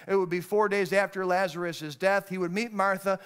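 ordinary talk, nothing marked

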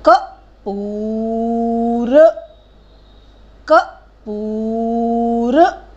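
कपूर slow, ऊ held long and rising. Shown as a demonstration. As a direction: model कपूर slowly. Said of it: "ऊ की मात्रा किस पे लगेगी?".

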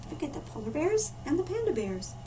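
usual speaking voice

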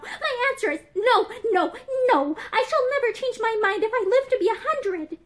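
high, emphatic refusal; acted heated insistence; vehement refusal. A narrator's reading. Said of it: "she added vehemently".

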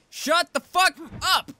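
furious shout at the game, drawn out; angry yell. Real, not acted. Gamer yelling at the monster, furious. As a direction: snap at the monster in real rage.

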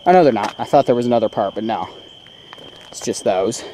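normal talking voice

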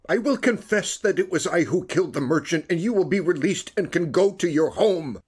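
high, loud, pleading voice; desperate plea. Acted. Makar kneeling before Askionov, high desperate plea. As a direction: beg him to let you make it right.

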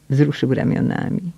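usual speaking voice

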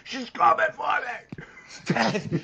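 high, slow, breathy panicked cry; hammed-up fright, high and slow; mock terror. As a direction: gasp it out in fake panic.

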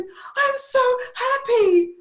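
very high, loud, drawn-out wail; mock happy sobbing. Client to her stylist after the makeover, hammed-up happy crying. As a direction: imitate blubbering through tears of joy. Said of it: "she gasps her breath".

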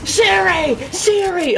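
very high, loud mock shriek; screaming at Siri. Mimicking a player yelling at Siri, high mock shriek. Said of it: "Start screaming at Siri".

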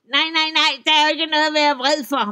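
high, loud, fast troll denial; the troll hurries to deny he's angry; flustered troll. Acted. Troll to Esben, denying anger, high, loud and fast.

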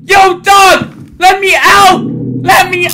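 loud, high-pitched frantic shouting; panicked screaming. Real, not acted. Player begging the game to let them out, panicked screaming. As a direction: yell desperately for release.

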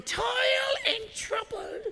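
high cackling witch voice; spooky old witch on trouble. Pretend. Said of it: "I don't know why I just did that voice".

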